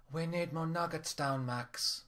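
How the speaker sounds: put-on soft-spoken, high Korean accent voice; quiet, lightly accented manager. A narrator's reading.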